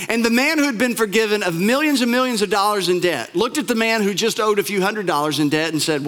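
normal talking voice

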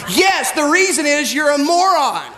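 acted-out marital exasperation; exasperated wife retort. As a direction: fire back at a hopeless husband. Wife snaps back at husband.

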